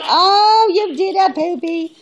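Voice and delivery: high and loud delighted squeal; excited squeal. Real, not acted. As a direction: burst out in delighted pet praise. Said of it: "repeated more excitedly".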